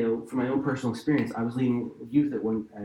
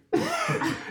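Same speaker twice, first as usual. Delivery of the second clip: high laugh. Real, not acted. Shared laugh at the story.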